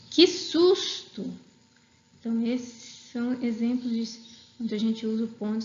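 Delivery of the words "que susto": "Que susto!" dito alto, agudo e bem lento; susto exclamado. Shown as a demonstration. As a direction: exclame com medo, devagar e forte. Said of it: "Admiração, medo". (professora exclama susto para os alunos)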